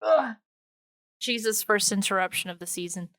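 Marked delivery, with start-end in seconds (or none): none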